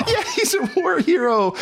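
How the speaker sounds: laughing it out high. Real, not acted. Co-host laughs agreeing he's a war hero, laughing.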